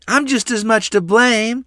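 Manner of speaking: put-on high, loud tearful child's confession; reluctant admission, crying. A narrator's reading. Lottie shares the blame, upset high child voice. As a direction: own up as an upset little girl. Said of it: "Lottie admitted reluctantly".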